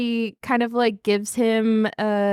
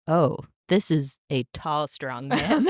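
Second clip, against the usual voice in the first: low, laughing, smitten take; swooning; 7 semitones lower, slower than usual. Shown as a demonstration. Podcaster acting the heroine falling for him, lovestruck. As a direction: let the words melt with attraction. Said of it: "and then it's like".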